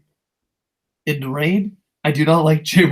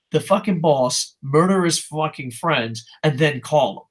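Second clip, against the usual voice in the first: ordinary talk, nothing marked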